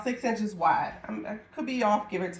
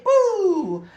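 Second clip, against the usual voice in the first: high loud playful Boo!; 10 semitones higher, 7 dB louder than usual. Real, not acted. Seller teasing her live viewers with a loud playful boo. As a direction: pop out a loud joking Boo!. Playful shout after chant.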